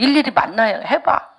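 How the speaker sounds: normal talking voice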